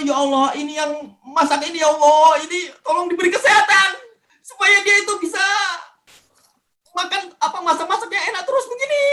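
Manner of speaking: high, soft pleading prayer; tearful prayer. Retold or imitated. Patient praying for the cook's health, tearful and pleading. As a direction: plead to God through grateful tears. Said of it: "Itu saya doain pada waktu makan".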